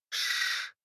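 sharp unvoiced shush; hissing shush. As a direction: hiss for silence. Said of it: "зашипела она".

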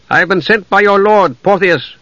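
high, projected formal announcement; authoritative half-elf. Acted. Envoy tells elves who sent him, authoritative. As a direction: address the warriors with authority.

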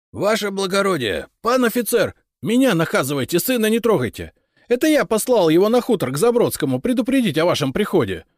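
loud, high-pitched desperate begging; father begging an officer for mercy; hoarse pleading. A narrator's reading. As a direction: plead urgently for your son, voice pushed high and loud. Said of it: "проговорил он хриплым голосом с мольбой".

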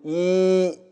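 إي said slowly with a long held ii; drawn-out demo. Shown as a demonstration. Teacher showing how إي is pronounced. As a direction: sustain إي, sound the sukun on the ya. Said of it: "كيف تنطق حينئذ".

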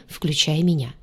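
ordinary delivery, nothing marked

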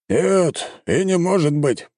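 high-pitched, emphatic denial; exclaiming. A narrator's reading. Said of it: "воскликнул командующий". (восклицая)

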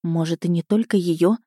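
ordinary delivery, nothing marked

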